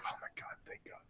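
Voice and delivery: breathy, whispered thanks; relieved whisper. Retold or imitated. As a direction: whisper it under your breath, grateful. Voices his relieved thought.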